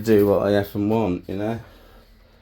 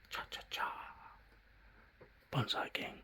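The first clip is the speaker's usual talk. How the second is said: whisper; 18 dB quieter than usual. Acted. Whispered intro jingle.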